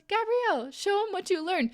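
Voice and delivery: high, bright call from the ski instructor; cheering instructor. Retold or imitated. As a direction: hype her up like a proud coach. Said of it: "And she goes".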